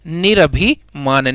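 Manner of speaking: निरभिमान drawn out, syllable by syllable; slow dictation. Shown as a demonstration. Teacher to students, dictating the word slowly. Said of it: "ये क्या हो जाएगा".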